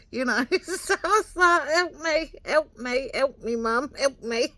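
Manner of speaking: loud, high laughing plea. Real, not acted. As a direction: beg for help through laughter. Laughing plea while retelling.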